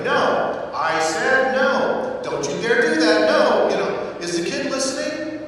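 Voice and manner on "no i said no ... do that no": high, loud scolding no; mock mother yelling. Mother scolding her whining kid, loud and high. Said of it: "they go".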